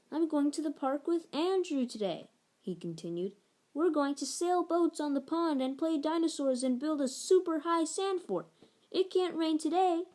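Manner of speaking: put-on bright little boy pleading to play outside; child voice. Boy telling Mama about his plans, eager child voice. Narrator voicing Little Bill.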